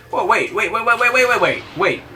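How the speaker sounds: rising alarmed repeated waits; urgent. Real, not acted. Sudden alarm in the game.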